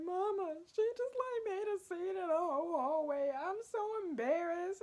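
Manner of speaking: high, wailing retelling of crying to mama; high crying whine. Retold or imitated. Daughter crying to mom on the phone, high and tearful. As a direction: whimper it up high like a kid in tears.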